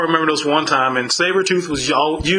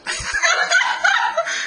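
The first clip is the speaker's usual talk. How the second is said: high shouting laugh. Real, not acted. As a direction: burst out laughing. Laughing at Colossus story.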